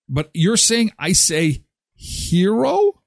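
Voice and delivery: ordinary talk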